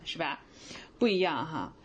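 normal talking voice

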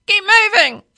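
high, very loud bird screech; screeching parrot. A narrator's reading. Parrot orders the driver onward, screeching. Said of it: "it screeched".

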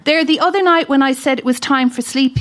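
ordinary talk, nothing marked

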